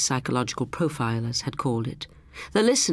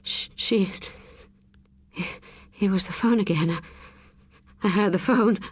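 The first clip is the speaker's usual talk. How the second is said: terrified stammer; 6 semitones higher than usual. A narrator's reading. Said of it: "Rosie could hardly speak".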